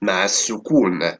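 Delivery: ordinary delivery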